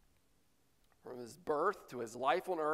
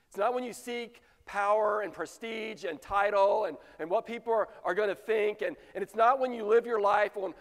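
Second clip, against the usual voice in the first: full-force preaching; 3 semitones higher, 4 dB louder than usual. Real, not acted. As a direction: preach it, build the fire.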